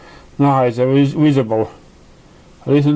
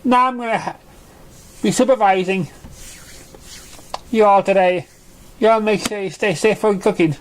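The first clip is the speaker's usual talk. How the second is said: high Pinkie Pie voice; 5 semitones higher than usual. Acted. Pinkie keeps talking to kids.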